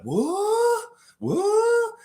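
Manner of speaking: very high, drawn-out squawk of confusion; baffled Raptor. Acted. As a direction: squeal it in cartoon disbelief.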